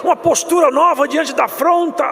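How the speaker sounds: high, loud full-force preaching to the congregation; fiery preaching. Acted. Pastor preaching the suffering of Jesus, loud and high.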